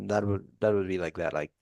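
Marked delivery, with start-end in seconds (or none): none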